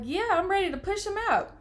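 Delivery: high-pitched, keen outburst; eager retort. Retold or imitated. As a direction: imitate yourself raring to go. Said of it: "I'm like".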